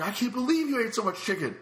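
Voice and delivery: high-pitched, incredulous imitation; exaggerated astonishment in a high voice; acted disbelief. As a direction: mimic an amazed friend, pitch way up.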